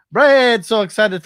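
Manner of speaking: sudden high shout; loud high yell. Acted. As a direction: belt out the name loud and high. Reading a funny chat message.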